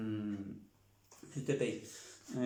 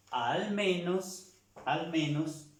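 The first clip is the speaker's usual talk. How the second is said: Al menos drawn out slowly and higher; slow emphatic; 5 semitones higher, slower than usual. Shown as a demonstration. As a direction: stretch Al menos for the class. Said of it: "¿cómo se escribe ese al menos?".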